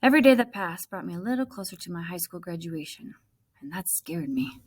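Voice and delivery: plain reading voice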